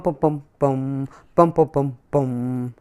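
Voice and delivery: ordinary delivery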